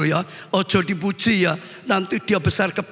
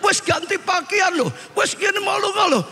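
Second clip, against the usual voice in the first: aggrieved wife; 10 semitones higher than usual. Retold or imitated. Wife complains husband never praises.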